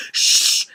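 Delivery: sharp unvoiced shush; a loud imitated shush. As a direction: hiss a loud shh at them. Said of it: "and they were like".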